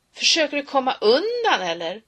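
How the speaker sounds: loud, high mocking yell; bully shouting a taunt. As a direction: jeer at him as he runs.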